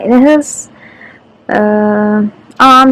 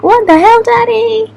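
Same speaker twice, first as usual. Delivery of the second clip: voicing the dog in a much higher, louder squeak; dog's voice; 12 semitones higher, 3 dB louder than usual. Voicing the crying dog Marvel, a high, indignant squeak. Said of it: "So he's like".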